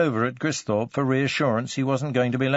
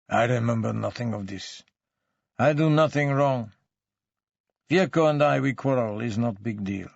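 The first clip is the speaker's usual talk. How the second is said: put-on sullen denial in accented, broken English; foreign-accented suspect, grudging and defensive; surly foreign suspect. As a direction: deny it all like a surly immigrant suspect. Suspect denies and plays it down.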